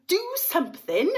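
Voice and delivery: wife screaming at her husband to do something; screamed order. A narrator's reading. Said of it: "Missus Gloop screamed".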